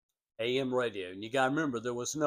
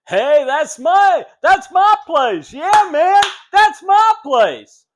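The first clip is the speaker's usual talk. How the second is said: much higher and much louder, drawn out, like someone who just heard his own name on the radio; excited shout; 14 semitones higher, 15 dB louder, slower than usual. Retold or imitated. Owner hearing his spot on the radio, shouting with joy. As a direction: shout it out slowly, thrilled to hear his shop.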